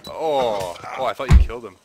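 loud surprised outburst; startled shout. Real, not acted. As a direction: jump at the shock. Sudden surprise in firefight.

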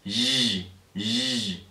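soft buzzing zh like a dentist's drill. Shown as a demonstration. Teacher showing the sound: drill-like buzz. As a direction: imitate the drill with a zh hum. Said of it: "como un taladro".

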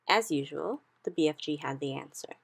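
plain reading voice

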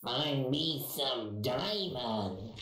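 slow gremlin croak; slow, strange gremlin voice. Acted. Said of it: "Sound like a gremlin".

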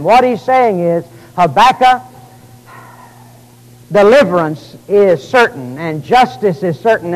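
rising preacher cadence, loud and emphatic; preaching. Acted. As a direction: preach it with full conviction. Preacher expounding scripture.

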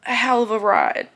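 ordinary delivery

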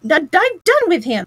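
high, loud exasperated stammer; cross outburst, tripping on the first word; severe. A narrator's reading. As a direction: splutter it, washing your hands of him. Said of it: "rat severely".

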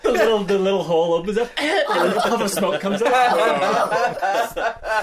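high, loud laughing through words; cracking up while talking. Real, not acted. Cracking up at the joke.

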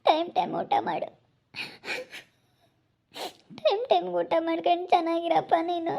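weepy send-off; tearful farewell. Acted. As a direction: give parting advice through put-on tears. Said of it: "ಯಾಕ್ ಅಳ್ತಾ ಇದ್ದೀರಾ?".

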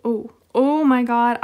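loud, high, slow gasp of dismay; horrified outburst. Real, not acted. Vlogger realizing she damaged a card, loud dismayed cry. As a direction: react like you just ruined something precious.

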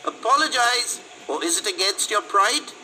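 put-on high, loud girlish taunt; mean girl's jeering challenge; cruel smirking girl. A narrator's reading. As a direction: goad him in a high girl's voice. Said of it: "the girl echoed with a cruel smirk".

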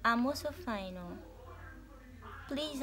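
ordinary talk, nothing marked

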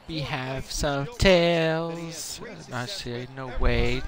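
ordinary delivery

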